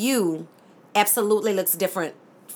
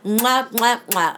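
blowing kisses; slower than usual. Real, not acted. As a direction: air kisses to the audience.

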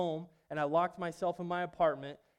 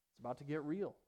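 ordinary delivery

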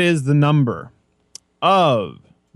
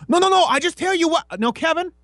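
much higher, hurried put-on protest; mock protest. Arguing with Kevin over spoilers, high mock protest. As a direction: squeak out a quick denial. Quoted back-and-forth at movie.

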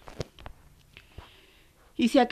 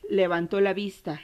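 usual speaking voice

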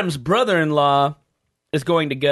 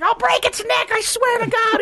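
very high, hammy movie quote; high squeaky woman voice. Retold or imitated. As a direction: squeak it out like a cartoon lady. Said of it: "She's like".

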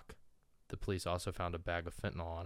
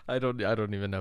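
laughing; 3 semitones higher, 10 dB louder, faster than usual. Real, not acted. Laughing after whisper bit.

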